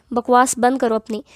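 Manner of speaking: usual speaking voice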